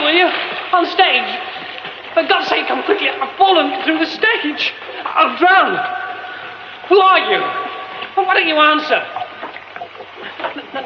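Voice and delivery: high, loud, frantic pleading with stammers; panicked pleading. Acted. Trapped man pleading with stranger to pull him out. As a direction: beg for help, voice climbing in panic.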